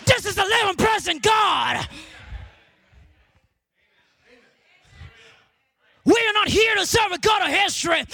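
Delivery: high, driving sermon delivery; impassioned preacher rousing the church; full-force preaching. Real, not acted.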